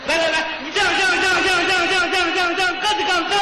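high, loud, fast cheering; loud egging on. Acted. Urging his partner to belt the high note, loudly. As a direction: whip him up to hit the top note.

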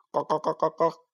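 clucking like a mother hen. A narrator's reading. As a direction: cluck like a hen calling chicks. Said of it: "鸡妈妈在草地上教小鸡捉虫子吃".

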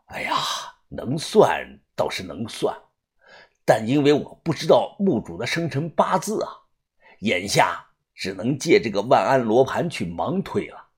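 put-on soft, low elderly murmur; low-voiced. A narrator's reading. Old master explaining the reckoning takes time, low-voiced. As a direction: keep it down, reluctant old master weighing it up. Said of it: "就听偏爷低声的又说".

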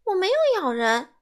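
high and loud indignant denial; loud protest. A narrator's reading. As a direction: shout it, offended. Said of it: "西蒙大声说". (西蒙大声否认自己咬人)

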